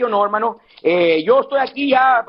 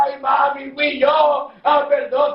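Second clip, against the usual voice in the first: mock grieving wail; slower than usual. Son crying at his mother's coffin, hammed-up wailing. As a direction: cry out in exaggerated remorse, mimicking a mourner. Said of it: "y ahí están llorando".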